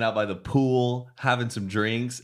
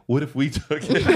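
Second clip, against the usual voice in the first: laughing; 10 semitones higher, 4 dB louder than usual. Retold or imitated. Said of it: "Mike was like".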